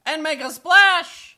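very high, slow squeaky otter. A narrator's reading. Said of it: "says the otter".

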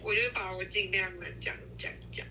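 usual speaking voice

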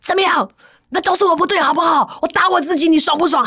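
husband shouting in bitter self-reproach; husband's bitter shout. Retold or imitated. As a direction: hurl it back at her in a rage. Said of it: "那个先生说".

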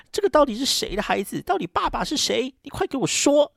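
a furious father demanding the truth; angry interrogation. Retold or imitated. Grandfather interrogates pregnant daughter.

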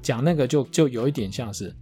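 ordinary talk, nothing marked

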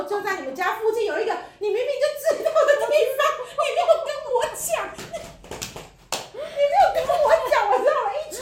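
high, loud, laughing protest; laughing complaint. Real, not acted. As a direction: laugh through the playful reproach. Teasing friend for not telling.

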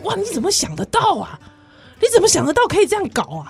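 high, fast amazed gasp of disbelief; amazed exclamation. Retold or imitated. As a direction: sound blown away by the idea. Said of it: "你会觉得说".

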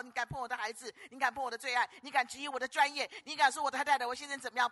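normal talking voice

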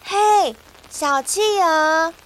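high calling voice across the water. Acted. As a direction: call out to the penguins. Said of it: "小熊大声地喊". (小熊朝小企鹅大声喊)

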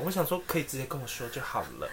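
ordinary delivery

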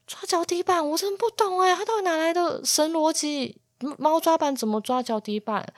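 high-pitched, incredulous questioning; utter bewilderment. Retold or imitated. Owner retelling reaction to the cat's absurd logic, bewildered. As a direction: puzzle over it in a high, baffled voice. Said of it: "然后我超困惑".

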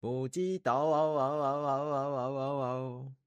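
high echoing genie cry. A narrator's reading. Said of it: "精灵的声音回荡在空气中".

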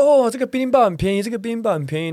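high, bright sales patter from a stall owner; pushy market seller. Retold or imitated. Retelling the stall owner luring a tourist with a high sales pitch. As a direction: hawk it like a street vendor to a tourist.

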